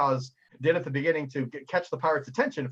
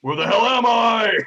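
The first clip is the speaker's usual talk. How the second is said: high, loud and slow, totally lost; dazed confusion; 8 semitones higher, 9 dB louder than usual. Acted. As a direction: come to, bewildered and shouting. Captain dazed after being thrown.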